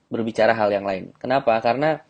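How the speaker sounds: ordinary delivery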